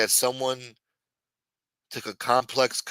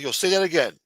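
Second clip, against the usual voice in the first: challenging gym teacher; 6 semitones higher, 4 dB louder than usual. Retold or imitated. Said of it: "he's like".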